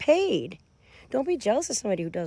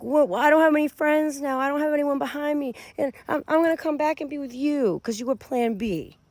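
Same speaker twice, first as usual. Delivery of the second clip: put-on self-pitying protest; imitated complainer; 5 semitones higher than usual. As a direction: mimic a friendless ex complaining, pitched up. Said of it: "They're like".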